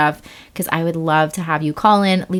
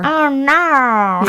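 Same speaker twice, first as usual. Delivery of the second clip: higher, louder Australian Oh, no; Australian accent; 6 semitones higher, 4 dB louder than usual. Pretend. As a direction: ham up the Australian Oh, no. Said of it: "the Australian one when they say".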